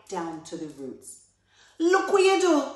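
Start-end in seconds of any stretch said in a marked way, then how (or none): 1.8-2.7 high, loud shout of protest; outraged cry. A narrator's reading. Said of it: "cried Anansi".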